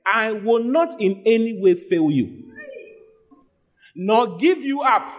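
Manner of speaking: fervent sermon delivery; preaching. Acted. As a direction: lift the verse up to the church. Preacher proclaims God's promise.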